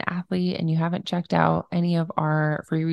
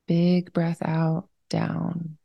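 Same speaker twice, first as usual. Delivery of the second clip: unhurried, even-toned breathing cues; guided meditation. Real, not acted. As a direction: guide them into slowing down, leaving room to breathe.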